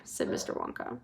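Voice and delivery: ordinary delivery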